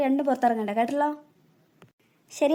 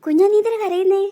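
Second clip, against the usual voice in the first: higher, louder worried call; alarmed; 5 semitones higher, 5 dB louder than usual. Acted. Older sister calls out, alarmed, to crying sibling. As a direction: rush to the crying little sister.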